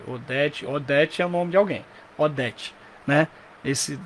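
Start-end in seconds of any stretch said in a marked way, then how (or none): none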